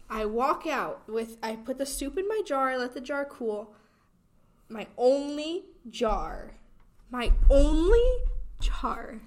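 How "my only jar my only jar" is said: "only" drawn out slowly, then punched much louder and higher; stress on only. Acted. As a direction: lean hard on "only". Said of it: "Emphasis on the word only".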